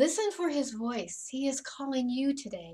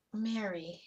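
soft call of a name; 3 semitones lower, 7 dB quieter than usual. Acted. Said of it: "the gardener softly said something".

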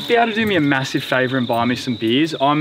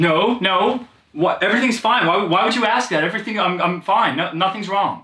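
flustered, stumbling denials at raised pitch; jumpy; 4 semitones higher than usual. Acted. Son hiding trouble from his dad, jumpy. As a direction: protest too much, tripping over the words. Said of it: "You seem jumpy".

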